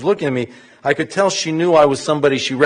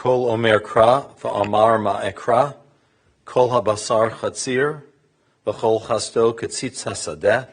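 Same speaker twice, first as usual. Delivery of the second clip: brisk, measured Hebrew recitation; scripture reading; 4 semitones lower, 5 dB quieter, faster than usual. Real, not acted. Clergy reads the prophet's Hebrew verses to mourners, formal recitation. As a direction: read the verses aloud in even, quick phrases.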